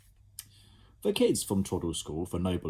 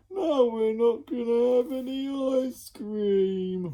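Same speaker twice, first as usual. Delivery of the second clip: moaning; 6 dB louder, slower than usual. Acted. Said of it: "moaned one".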